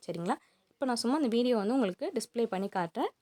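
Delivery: normal talking voice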